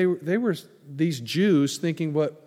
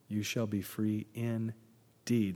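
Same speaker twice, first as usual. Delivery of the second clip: solemn reading; 3 semitones lower, 5 dB quieter, slower than usual. Shown as a demonstration. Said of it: "That's not just somebody with an English accent".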